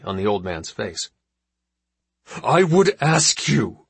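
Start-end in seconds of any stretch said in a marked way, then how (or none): 2.4-3.9 slow, strained, through clenched teeth; holding back rage through his teeth; through gritted teeth. Acted. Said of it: "said his father through his teeth".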